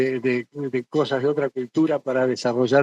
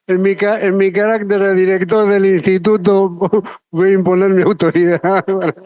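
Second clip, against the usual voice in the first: jokingly pulling rank through laughter, higher and louder; mock authority, laughing; 5 semitones higher, 5 dB louder than usual. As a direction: hacerse el director autoritario, riéndose. Joking about closing by director's authority. (autoridad fingida entre risas)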